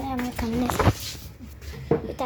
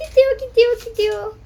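louder, higher cheering along; excited cheering; 4 semitones higher, 6 dB louder than usual. Real, not acted. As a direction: cheer her on as she rolls. Cheering friend riding away.

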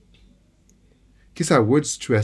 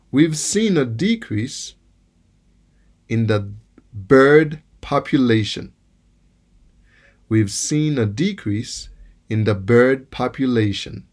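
decrease stressed on DE, slow; noun stress on DE; slower than usual. Shown as a demonstration. Teacher reading the noun example slowly, stress on DE. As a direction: read slowly with the weight on DE in decrease. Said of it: "nou ap pran dezyèm mo a".

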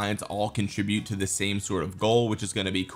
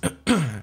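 throat clear; 4 semitones higher, 3 dB louder than usual. Real, not acted. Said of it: "put on my announcer voice".

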